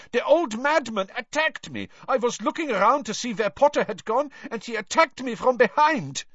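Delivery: put-on high, Eastern European accented protest; heavy Eastern European accent, indignant and high; aggrieved Krum. A narrator's reading. Krum telling of the attack from behind, accented protest. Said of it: "Krum muttered".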